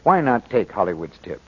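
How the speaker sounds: normal talking voice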